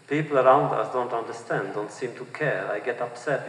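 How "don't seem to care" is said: usual speaking voice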